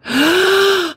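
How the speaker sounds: high excited gasp; sharp gasp. Real, not acted. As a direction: gasp in delight. Finds pry bar.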